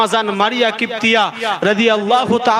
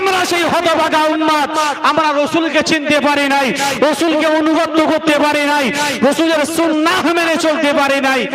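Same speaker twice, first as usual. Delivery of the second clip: high, sustained preacher's cry; high-pitched sermon, insistent repeated phrases; impassioned preaching; 8 semitones higher than usual. Real, not acted. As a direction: proclaim each confession to the crowd.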